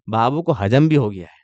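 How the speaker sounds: ordinary delivery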